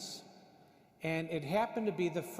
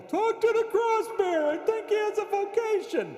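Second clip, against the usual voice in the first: very high, loud cartoon moose voice; Father Fred; 9 dB louder than usual. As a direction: blare it out like Bullwinkle. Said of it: "all I hear is Bullwinkle".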